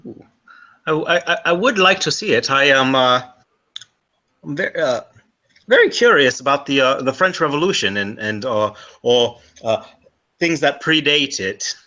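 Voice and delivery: a bad Liam Neeson-style Irish accent; an Irishman with a shaky brogue. Irish traveller asking the scholar about libraries, bad Irish accent. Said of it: "You're at a bad Liam Neeson impression".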